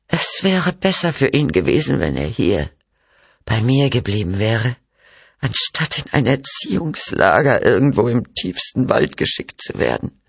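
high, thin, pained voice; reproachful mother. Acted. Mother laments son's exile to camp, reproachful. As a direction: voice a frail mother, pitched mostly up.

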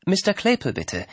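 a firmer second ask; a touch of insistence. A narrator's reading. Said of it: "wiederholte Anne".

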